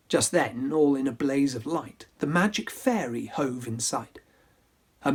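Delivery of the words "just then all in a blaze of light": ordinary delivery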